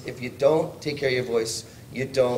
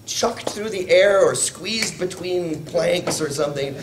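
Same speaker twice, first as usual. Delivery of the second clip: ordinary talk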